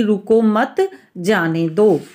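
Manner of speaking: "रुको मत", a clear break, then "जाने दो"; pause after मत. Shown as a demonstration. Hindi teacher showing how pauses shape a sentence, pause after मत. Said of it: "जब हम बोलते समय, अ, रुकते हैं".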